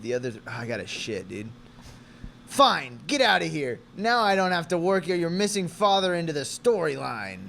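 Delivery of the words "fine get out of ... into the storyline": loud, raised-pitch send-off; angry dismissal. Acted. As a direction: dismiss the players in a huff. Acted quarrel, dismissing the friends.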